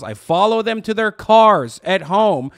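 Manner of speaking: heated rant. Real, not acted. Streamer ranting about pundits, loud and rising.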